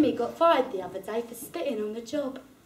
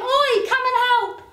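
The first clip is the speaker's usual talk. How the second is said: much higher, loud call for help; shout; 11 semitones higher, 6 dB louder than usual. Retold or imitated. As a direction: call across the road. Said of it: "I shout him".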